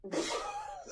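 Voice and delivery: sharp high laugh; high squeal of laughter. Real, not acted. Reacts to the smell.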